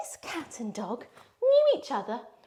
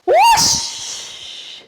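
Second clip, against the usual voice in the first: loud, high breathy whoosh sound; magic whoosh; 10 dB louder than usual. Acted. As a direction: swoosh it out like a magic spell. Coat flip magic trick.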